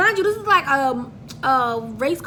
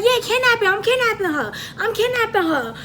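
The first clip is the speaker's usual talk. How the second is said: mocking yell; 7 semitones higher than usual. Driver mimicking her kidnapping cry to cops, mock announcement. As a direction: call it out the window, playing along. Driver yells back out window.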